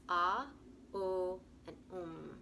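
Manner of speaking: Ah, O, Um each drawn out; three syllable Om. Shown as a demonstration. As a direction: split the Om into three slow syllables. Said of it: "three different syllables".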